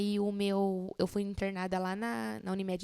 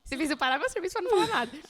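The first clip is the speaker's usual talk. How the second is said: higher, louder, quick mock complaint; mock annoyed coworker; 7 semitones higher, 4 dB louder, faster than usual. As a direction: tease her like an irritated coworker.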